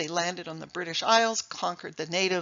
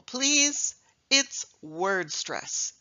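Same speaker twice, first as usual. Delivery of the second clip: ordinary talk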